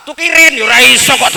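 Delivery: high, loud jeer at Tukirin; loud teasing. Real, not acted. Teasing Tukirin that he can't sing, loud high jeer. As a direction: call him out loudly for the room.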